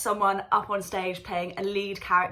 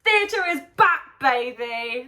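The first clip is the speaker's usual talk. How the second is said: far higher and louder shout, stretched out; bigger triumphant cheer; 5 semitones higher, 7 dB louder, slower than usual. Real, not acted. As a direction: belt it out even bigger. Repeat even bigger.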